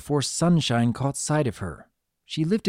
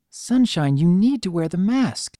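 higher, urgent nurse's plea; distraught nurse; 6 semitones higher, 4 dB louder than usual. A narrator's reading. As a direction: urge the patient, mildly distraught. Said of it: "looking distraught".

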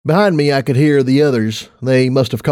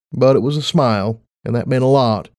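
ordinary delivery, nothing marked

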